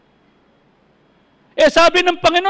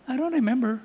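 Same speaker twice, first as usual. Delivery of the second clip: much softer, gentle and forgiving; gentle denial; 4 semitones lower, 10 dB quieter than usual. Retold or imitated. Pastor gently telling a member he forgets the wrong. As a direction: let it go lightly.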